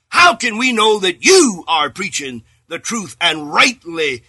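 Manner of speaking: steady, emphatic preacher's cadence; preaching at full force. Acted. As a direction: hammer each "you say" to the congregation. Preacher's rhetorical run.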